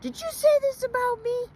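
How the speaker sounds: high, loud indignant challenge; confronting accuser. Acted. Animal confronting the accuser, indignant. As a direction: demand answers from the gossip. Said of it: "the accuser was and say".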